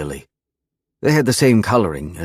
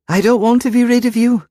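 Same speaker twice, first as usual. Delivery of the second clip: put-on high girlish voice, said hotly; a young girl protesting with heat; 7 dB louder, faster than usual. A narrator's reading. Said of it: "she said hotly".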